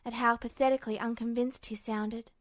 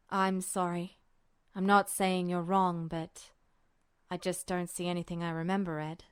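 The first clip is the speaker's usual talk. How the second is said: put-on lower, softer, apologetic young man; apologetic Roy; 7 semitones lower, 3 dB quieter than usual. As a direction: let him down gently, unsure.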